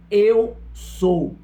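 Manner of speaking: forceful, spaced-out proclamation; Jesus's declaration. Retold or imitated. Preacher reading Jesus's words from John, forceful spaced declaration. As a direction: ring out each word with a pause between. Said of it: "falou-lhe Jesus outra vez dizendo".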